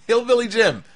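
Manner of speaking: high, incredulous exclamation after a laugh; amused disbelief. Real, not acted. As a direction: repeat the name as if you can't believe it. Repeats the name in amused disbelief.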